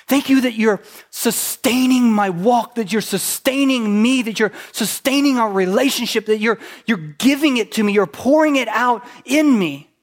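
loud, high, eager thanks; a mock prayer of loud thanks; fervent thankful prayer. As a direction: pour out gratitude to God. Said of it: "have you ever just said".

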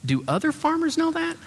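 very high, wide-eyed gushing question; gushing admiration. Shown as a demonstration. Pastor showing the wife how to admire her husband, gushing. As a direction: play the amazed admiring wife. Coaching wife's admiring line.

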